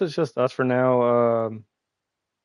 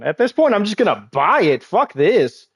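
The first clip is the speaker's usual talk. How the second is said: loud exasperated rant, high-pitched; frustrated outburst; 7 semitones higher, 7 dB louder than usual. Real, not acted. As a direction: throw up your hands and yell it. Frustrated at game being forced.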